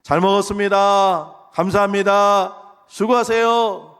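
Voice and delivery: loud, high, drawn-out chant of thanks; big singsong demonstration for the congregation; model thanks. Shown as a demonstration. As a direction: call out each polite phrase slowly and loudly. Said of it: "뭐라 그래야 돼요".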